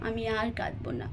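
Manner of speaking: whispered, still choked up; whispered promise. Acted. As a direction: breathe the promise out through the tears. Crying girl promises her aunt.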